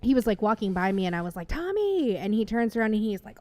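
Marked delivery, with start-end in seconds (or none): 1.5-2.2 calling out. Retold or imitated. Calling out to celebrity.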